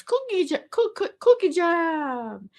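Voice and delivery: slow, high mock Jamaican accent, stuttered; hammed-up Jamaican accent on the game name; imitating Jamaican accent. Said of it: "in a Jamaican accent".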